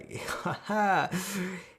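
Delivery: high-pitched character laugh; acted laugh. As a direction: let out a high, drawn laugh.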